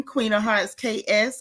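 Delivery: ordinary talk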